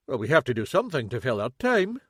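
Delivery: normal talking voice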